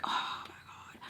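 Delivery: voiceless, exasperated undertone; exasperated whisper. Real, not acted. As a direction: let it out as a regretful whisper. Cringing at her own regretted favor.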